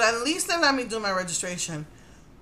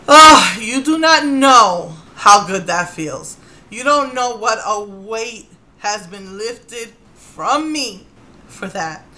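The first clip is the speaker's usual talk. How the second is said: heartfelt relief; 10 dB louder, much slower than usual. Real, not acted. Describing her relief.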